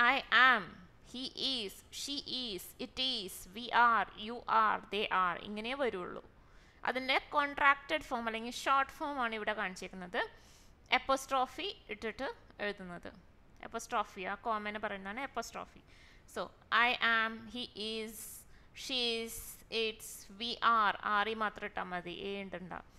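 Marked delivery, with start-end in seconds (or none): 0.1-5.4 slow drilling. Shown as a demonstration. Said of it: "drilling verb forms". 16.9-19.4 slow drilling. Shown as a demonstration. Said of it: "drilling verb forms". 19.8-21.3 slow drilling. Shown as a demonstration. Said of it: "drilling verb forms".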